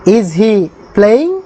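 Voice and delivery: the whole question drawn out very slowly; slow dictation. Shown as a demonstration. Teacher reads the English question very slowly to the class. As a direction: say it slowly in one breath. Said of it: "teacher dictating English translation".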